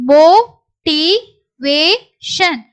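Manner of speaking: slow syllables with clear gaps. Shown as a demonstration. Teacher sounds out the word for learners, syllable by syllable. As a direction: break "motivation" into four separate beats.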